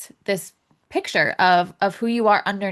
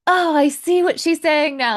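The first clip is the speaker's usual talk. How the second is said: mock realization; 8 semitones higher, 5 dB louder than usual. Retold realization about her chart.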